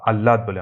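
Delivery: আহ্লাদ said "al-laad"; doubled l, no h. Shown as a demonstration. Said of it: "লর উচ্চারণটা দ্বিতীয় করে হাওয়াটাকে বাদ".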